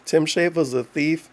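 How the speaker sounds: usual speaking voice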